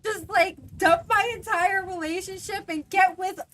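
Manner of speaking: high, loud, incredulous rant; exasperated rant. Retold or imitated. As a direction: vent in disbelief. Ranting about caller's expectation.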